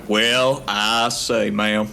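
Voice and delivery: lightly put-on Texas drawl; Texas accent. Shown as a demonstration. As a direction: try out a Texan accent. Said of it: "Maybe I should do a Texas accent".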